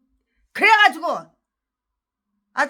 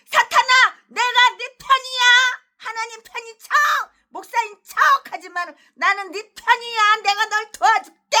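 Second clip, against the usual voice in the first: higher, louder mock pledge of loyalty; mocking pastor quote; 7 semitones higher, 6 dB louder than usual. Preacher mocking a pastor's vow to Satan, higher mock devotion. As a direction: sneer as the fake pastor. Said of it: "전라도 개목사도".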